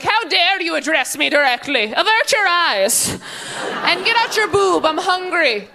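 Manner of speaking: imperious, high-pitched royal demand; haughty monster baby. Pretend. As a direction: play a tiny tyrant giving orders. Future kid as haughty monster.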